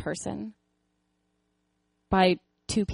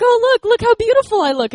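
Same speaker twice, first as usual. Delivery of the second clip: much higher, louder preening voice; vain mock self-admiration; 11 dB louder than usual. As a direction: show off to God like a vain child. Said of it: "I'm like".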